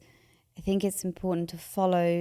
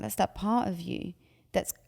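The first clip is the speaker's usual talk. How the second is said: normal talking voice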